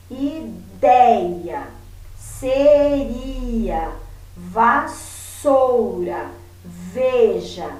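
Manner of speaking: "Ideia, seria, vassoura, veja" word by word, strong syllables drawn out; exaggerated syllable stress. Shown as a demonstration. Teacher helping pupils hear stress, "Ideia, seria, vassoura, veja" with exaggerated strong syllables. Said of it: "falo uma sílaba mais forte".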